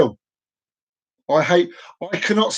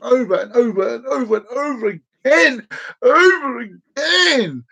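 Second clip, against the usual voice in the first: each "over" higher, the last "again" stretched out; exaggerated repetition; 7 semitones higher than usual. Fan enjoyed those films, exaggerated repeated "over". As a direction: climb in pitch on every "over", drawing out "again".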